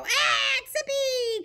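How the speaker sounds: high, squealing mock fright; mock scared kid. Teacher imitates kids afraid of a bee, high and squealing. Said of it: "they go".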